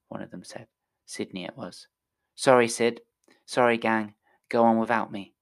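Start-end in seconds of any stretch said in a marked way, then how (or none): 2.4-5.4 loud call down to friends from the window; boy calls down from window to friends, loud. Acted. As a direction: shout an apology to the kids below. Calling down to kids below.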